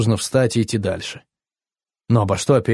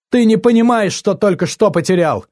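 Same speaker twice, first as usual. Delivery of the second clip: much higher, louder reproach; heated, high-pitched final word; indignant parting shot; 9 semitones higher, 4 dB louder than usual. A narrator's reading. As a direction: throw a last bitter warning while leaving. Her last shot as she leaves.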